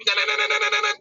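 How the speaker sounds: high, repeated na na engine buzz; imitated engine revving. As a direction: mimic bikes revving at the limiter. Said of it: "you know, how they're like".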